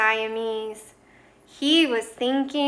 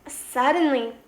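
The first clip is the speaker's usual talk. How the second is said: usual speaking voice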